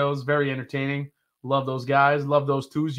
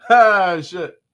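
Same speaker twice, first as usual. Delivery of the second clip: ordinary talk, nothing marked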